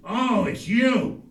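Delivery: loud, high, drawn-out recognition; mock exasperated phone answer; unwelcoming father. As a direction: greet the caller with exaggerated dismay. Father answers son's phone call.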